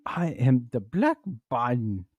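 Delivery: put-on African voice for a politician; African accent. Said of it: "if Eric Adams had the African voice".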